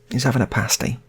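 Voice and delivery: plain reading voice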